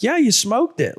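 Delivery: high-pitched, emphatic retold cheer; excited praise. Guide showing hunter the video, excited. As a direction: re-enact thrilled confirmation.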